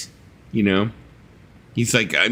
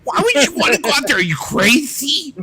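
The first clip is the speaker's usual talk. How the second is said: Richard Dolan voice; 13 semitones higher than usual. Retold or imitated. Prank Alexa ranting in Richard Dolan's high voice. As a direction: shout the weather like Richard Dolan. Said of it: "the voice of Richard Dolan".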